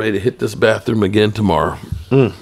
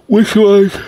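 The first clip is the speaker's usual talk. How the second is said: high emphatic; 12 semitones higher, 6 dB louder than usual. Real, not acted. Rhetorical emphatic question.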